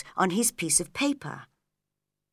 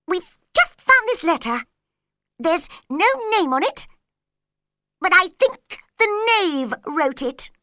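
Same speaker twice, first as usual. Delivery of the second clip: high, loud excited rush; 10 semitones higher, 7 dB louder than usual. A narrator's reading. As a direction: blurt out the news. In a great hurry.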